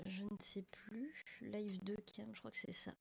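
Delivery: breathy whisper to herself, slowed down; whispered aside. Real, not acted. Streamer whispering to herself while setting up two cameras. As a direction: murmur it under your breath as you think.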